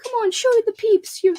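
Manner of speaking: high squeaky pleading; playful high-pitched doggy talk; coaxing a pet. Real, not acted. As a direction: coax the dog, rising to a squealed no. Coaxing dog toward the camera.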